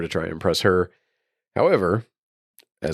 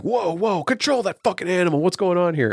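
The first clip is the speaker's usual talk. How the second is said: much higher-pitched mock panic; alarmed outburst; 12 semitones higher than usual. Man confronts police as their dog goes crazy, alarmed outburst. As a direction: act out a man yelping orders about an animal. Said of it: "the dog is going crazy".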